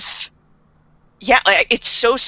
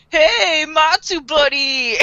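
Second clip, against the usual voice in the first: put-on slow, higher, loose drunken greeting; kind of drunk voice; 6 semitones higher, much slower than usual. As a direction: drawl it out like a tipsy pal. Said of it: "kind of drunk voice".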